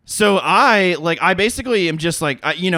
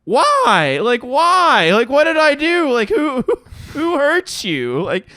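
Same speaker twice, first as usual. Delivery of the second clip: much higher, louder pleading "why", laughing through it; baffled high plea; 9 semitones higher, 3 dB louder than usual. Retold or imitated. Storyteller pleading with the stranger who hit him, high baffled plea. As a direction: squeak out your disbelief at the stranger. Retelling his own baffled reaction.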